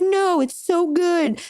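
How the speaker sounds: lightly voiced boyfriend, high and slow; mock boyfriend. Boyfriend refusing to switch shows, high and slow. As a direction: drag out the praise in a high voice. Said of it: "And he was like".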